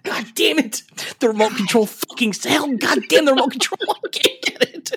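high, strained comic exasperation; mock frustrated cursing. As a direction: curse like a hammed-up helpless grouch. Acts Professor X cursing dropped things.

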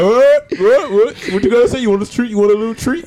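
put-on high cooing baby talk to a dog; mock dog-owner coo. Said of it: "And he just like".

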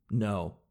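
firm "No." dropping low; decisive low no. Shown as a demonstration. Refusing the zoo clerk, decisive low no. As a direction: end it with a low "No.".

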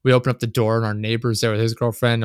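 ordinary talk

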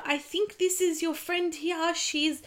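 put-on worried stranger on the phone, Greek accent. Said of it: "sorry about this accent".

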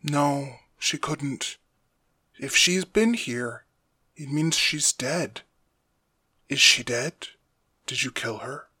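soft, slow, probing questions; quiet captive father. Acted. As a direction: question your son in a hushed voice.

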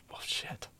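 whispered muttered curse to oneself; hushed dismay. Retold or imitated. Storyteller reliving his thought while being beaten, hushed dismay. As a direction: say it under your breath, voice dropped away.

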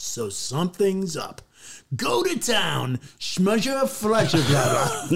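hammed-up ad slogan, stretched out; sing-song pitch into silly nonsense brand name; commercial read. Reads commercial audition line.

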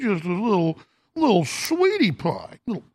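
put-on high, slow singsong baby talk; mock cooing. Host mocking a fraudster as sweetie pie, saccharine cooing. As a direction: coo sweetly with exaggerated innocence. Cooing mockery of Sam Bankman-Fried.

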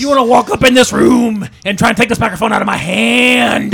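loud, gruff wrestler shouting brother; wrestling promo. Acted. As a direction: cut a hammed-up wrestling promo.

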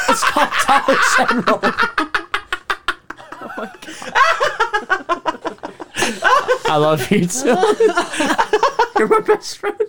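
giggling high through the words; can't stop laughing while talking. Real, not acted. Telling friends about Dylan, giggling high through it.

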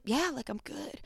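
faking fine. Retold or imitated. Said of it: "And I was like".

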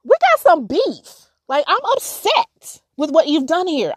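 high, loud, blunt venting to God. Retold or imitated. Telling God off after her daughter died, loud, high. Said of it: "I was like".

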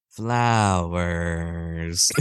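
low, drawn-out robot voice; deep, slow mock-creepy bot. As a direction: stretch it out low, like a head slowly turning. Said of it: "turns his head slowly, looks at Lenny and goes".

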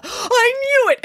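very high, indignant cry; accusing outburst. A narrator's reading. Cook accuses headmaster of secret cooking, high indignant outburst. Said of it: "accused Missus Marigold".

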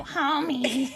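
cartoon housewife voice with a laugh; Marge Simpson. Shown as a demonstration. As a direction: call the husband as the cartoon mom. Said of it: "the Marge impression was a surprise".

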